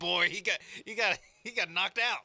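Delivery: nerdy analyst voice. Retold or imitated. Co-host imitating a stat nerd reacting to a big hit, excited. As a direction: play the geeky analyst getting hyped. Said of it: "be like".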